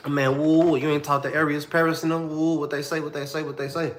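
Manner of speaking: begging friends to say what they heard; desperate questioning. Retold or imitated. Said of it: "pleading for news".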